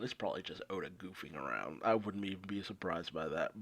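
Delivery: whispered low aside; confiding a hunch, whispered; muttered aside. Real, not acted. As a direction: drop it under your breath.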